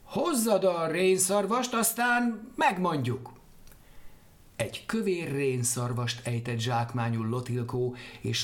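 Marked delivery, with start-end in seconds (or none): 0.0-3.3 high, slow birdlike voice; bird voices. Acted. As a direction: chirp it out like a flock of birds. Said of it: "Mire a madarak". (magas, lassú madárhang)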